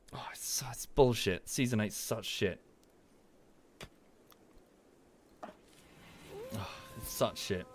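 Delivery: soft, breathy grumble under the breath; quiet disgusted aside; muttered disgust. Real, not acted. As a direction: mutter it to yourself, fed up.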